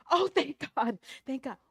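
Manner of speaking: high, loud burst of relief; acted relief. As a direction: cry out thanks from the cliff. Jack relieved to hear a voice.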